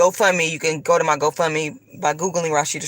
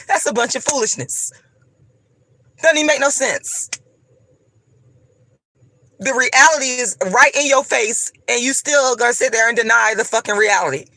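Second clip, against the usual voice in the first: annoyed rant; 5 semitones higher than usual. Real, not acted. Vlogger scolding viewers who deny reality, in a heated, raised voice. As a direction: vent at the people who deny the obvious. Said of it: "I'm getting fucking annoyed".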